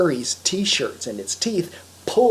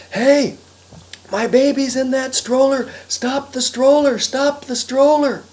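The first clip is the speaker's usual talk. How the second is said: high, loud urgent yell; 9 semitones higher, 6 dB louder than usual. Acted. Harry yells at the woodchuck to stop the stroller, yelling. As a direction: call it out hard down the road. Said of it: "Harry began to yell".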